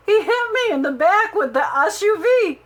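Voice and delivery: a squeal of real disbelief at the punchline; excited disbelief. Real, not acted. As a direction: blurt it out amazed and laughing. Laughing about being hit by SUV.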